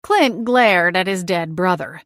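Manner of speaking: ordinary delivery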